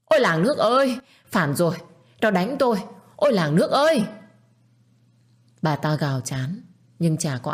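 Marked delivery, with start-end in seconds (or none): 0.1-4.0 high, loud cry for help; wailing outcry. A narrator's reading. Aunt calls villagers after being shoved, wailing outcry. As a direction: holler to rouse the whole village. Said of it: "Bà ta tiếp tục gào lên".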